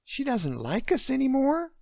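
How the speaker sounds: put-on high child's voice, alarmed; a small girl suddenly worried; alarmed little girl. Little girl asking her daddy, alarmed. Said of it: "Alarm flashed across Emily's eyes".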